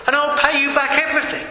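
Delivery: hurried, high-pitched plea; begging. A narrator's reading. Servant promising his master repayment, begging. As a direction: promise quickly, pleading high. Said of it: "he begged".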